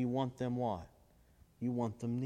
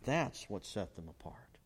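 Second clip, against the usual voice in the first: whisper; 6 semitones lower, 7 dB quieter than usual. Acted. Preacher drops to a whisper.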